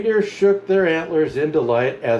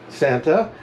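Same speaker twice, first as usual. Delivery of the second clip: usual speaking voice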